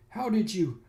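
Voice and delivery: lightly voiced high, startled woman; mock-startled comic character; voicing the shocked woman. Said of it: "says the following".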